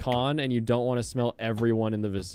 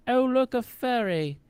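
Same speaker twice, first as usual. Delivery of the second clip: high, slow mock pseudo-British voice; pseudo-British accent; 13 semitones higher than usual. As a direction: imitate her pseudo-British South African accent. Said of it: "pseudo-British South African accent".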